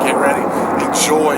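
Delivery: ordinary talk, nothing marked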